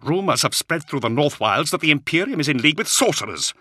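high, loud, angry outburst; spitting anger. A narrator's reading. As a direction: spit out the report with disgust. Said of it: "He spat angrily".

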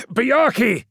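high, loud alarmed cry; cried out. A narrator's reading. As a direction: call out to stop him. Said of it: "cried Promus".

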